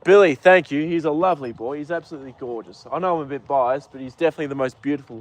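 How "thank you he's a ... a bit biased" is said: usual speaking voice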